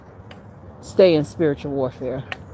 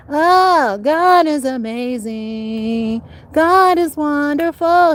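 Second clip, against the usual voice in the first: much higher, slow, drawn-out rapture; ecstatic praise; 8 semitones higher, much slower than usual. Real, not acted. Praising God to the viewers: high slow ecstatic praise. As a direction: savour each word of praise.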